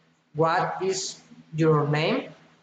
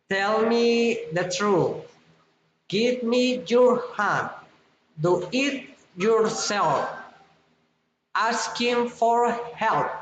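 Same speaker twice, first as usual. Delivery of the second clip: each command drops at its end, as on "truth"; falling commands; 3 semitones higher than usual. Shown as a demonstration. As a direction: bring "hand", "yourself", "help" down like orders. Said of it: "Commands falling intonation".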